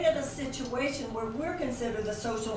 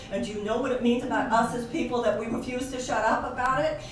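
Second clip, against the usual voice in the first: ordinary talk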